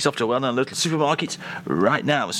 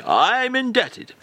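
higher, slow drawn-out catchphrase; Cyril Fletcher; 9 semitones higher, slower than usual. Retold or imitated. Host quoting Cyril Fletcher's catchphrase, higher and slow. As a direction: give it the old comic's slow relish. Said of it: "as Cyril Fletcher used to say".

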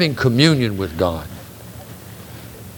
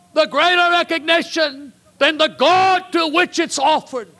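full-force preaching. Acted. Preacher warning his congregation about worship, soaring shout. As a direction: lift it to a soaring pulpit shout.

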